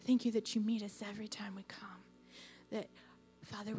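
fast breathless whisper of thanks; whispered prayer. Real, not acted. Softly thanking God in prayer.

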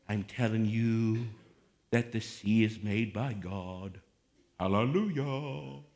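slow, low, drawn-out pious intoning; mock holy voice. Preacher parodying how Jesus supposedly spoke, droning holy voice. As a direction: intone it like a solemn churchman. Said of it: "was speaking like this".

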